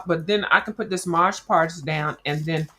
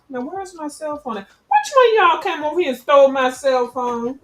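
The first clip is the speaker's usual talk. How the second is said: playful accusation; 9 semitones higher, 5 dB louder than usual. Pretend. Mock accusing audience.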